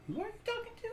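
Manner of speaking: very high, very soft voice; hushed question. Acted. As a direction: ask it in a tiny, high hush.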